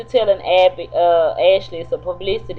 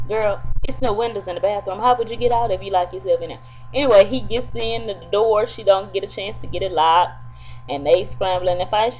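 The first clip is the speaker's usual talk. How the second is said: ordinary talk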